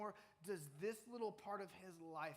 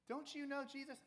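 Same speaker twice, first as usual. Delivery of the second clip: put-on higher, curious little girl asking; a small girl poking at Peter, pitched up; 9 semitones higher than usual. Servant girl questions Peter in a high little-girl voice.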